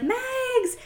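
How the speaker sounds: a high, cheery call of the name; excited greeting. Retold or imitated. Boyfriend answers the FaceTime at a bar, high cheery call. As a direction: greet her like a friend at a bar.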